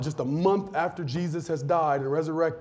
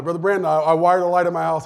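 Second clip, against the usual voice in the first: put-on louder, eager kid showing off; proud boast; 7 dB louder, faster than usual. Boasting to Brother Brandon.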